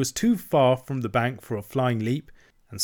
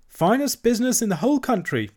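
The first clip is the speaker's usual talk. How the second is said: breezy and much higher, tossed off; airy; 12 semitones higher, 4 dB louder than usual. A narrator's reading. Toad bragging to the barge woman, airy and high. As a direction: boast lightly as if it costs nothing. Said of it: "said Toad airily".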